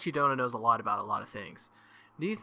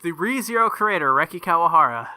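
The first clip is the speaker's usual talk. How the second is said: grand announcement; 3 semitones higher, 7 dB louder than usual. Pretend.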